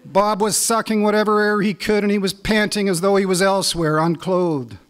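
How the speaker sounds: spoken-word poetry reading; poem reading. Acted. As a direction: read it like a performed poem, building with the story. Reads his hockey poem.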